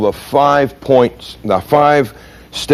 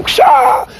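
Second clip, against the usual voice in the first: sharp high burst of breath; a quick martial-arts "Ksha!" yelp; judo sound effect. Shown as a demonstration. Instructor demos a judo move with a "Ksha!". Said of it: "I can just go".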